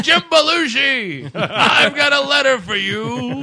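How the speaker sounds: loud, slow put-on Texas drawl; hammed-up Texas messenger voice; fake Texas accent. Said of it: "He's doing a bad Texas accent".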